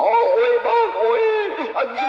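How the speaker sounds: sudden high, loud yelp; startled yell. Real, not acted. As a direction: shout out in shock. Sudden outburst after baby talk.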